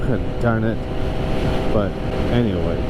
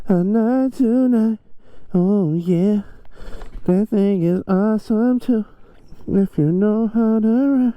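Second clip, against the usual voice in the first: excited; 9 semitones higher than usual. Real, not acted. Raised excited voice riding.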